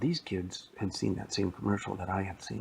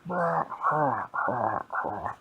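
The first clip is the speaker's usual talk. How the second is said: monster growl; 4 semitones higher, 4 dB louder, slower than usual. Acted. Monster chasing the kids.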